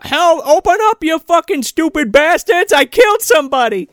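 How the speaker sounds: high, loud cry for help; mock frantic yell. Man at the police's door confessing, mock frantic yell. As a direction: holler it like banging on a door. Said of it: "he's banging on the fucking police's door".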